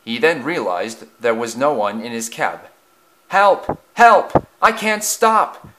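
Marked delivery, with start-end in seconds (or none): 3.3-5.7 high, loud cry for help; panicked cry. A narrator's reading. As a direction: cry out as a runaway engine. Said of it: "he cried".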